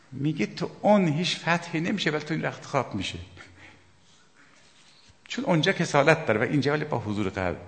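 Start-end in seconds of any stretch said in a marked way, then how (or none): none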